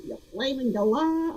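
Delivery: put-on a rough, tongue-in-cheek try at an Australian accent; attempted Australian accent. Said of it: "heard me try to do that accent".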